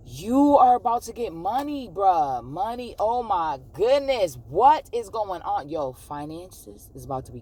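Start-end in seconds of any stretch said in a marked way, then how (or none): 0.1-5.7 high, loud burst of excitement; thrilled, swooping delivery; excited amazement. Real, not acted. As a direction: exclaim in amazement, stretching the big words. Reacts to money coming in reading.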